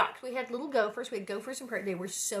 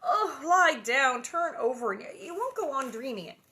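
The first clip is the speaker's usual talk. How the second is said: sleepy wife's yawned order; yawning; 4 semitones higher, 7 dB louder than usual. A narrator's reading. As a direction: yawn through it, higher then settling. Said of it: "Ma yawned again".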